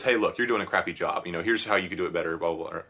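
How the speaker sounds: usual speaking voice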